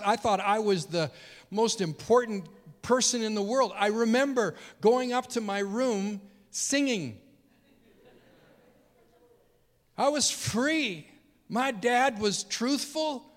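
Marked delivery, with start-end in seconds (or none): 3.8-13.2 joyful. Real, not acted. Joyful memory of forgiveness.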